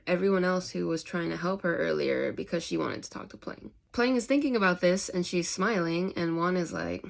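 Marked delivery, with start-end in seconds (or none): none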